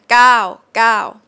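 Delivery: gao with a long vowel, said firmly. Shown as a demonstration. Teacher modelling how gao is really said. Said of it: "it's actually pronounced long".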